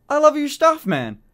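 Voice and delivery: high, loud gushing praise; gushing fan. Retold or imitated. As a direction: squeal it like an excited fan meeting his idol. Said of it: "he turned to me and he went".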